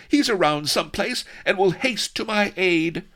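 high, loud heroine clinging to hope; frantic cry. A narrator's reading. Vanity insists rescue is coming, frantic. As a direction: insist frantically, pitched right up. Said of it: "cried Vanity frantically".

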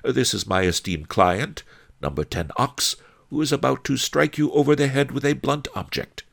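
high character voice, speeding up at the end; courteous sage. A narrator's reading. Master Li presenting his client to the thug, courteous. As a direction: introduce him politely, then rattle off the threat.

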